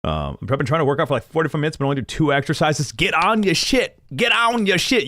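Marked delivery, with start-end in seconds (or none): 2.9-5.1 high-pitched, drawn-out urging; mock pep talk. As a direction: push the order up high, like hyping someone up. Mock pep talk about workout.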